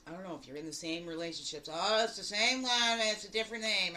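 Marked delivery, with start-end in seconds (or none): 1.7-4.0 mock whiny complaint. Voicing someone in repeat relationships.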